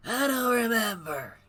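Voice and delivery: put-on cranky old hermit calling out; old man voice. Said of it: "how to do old man voice".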